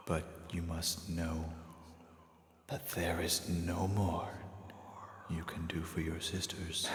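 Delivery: soft, slow, half-whispered stranger; quiet, slow, breathy-free whispered calm; mysterious hooded stranger. Acted. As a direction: hush it like a figure in the dark. Stranger speaks gently.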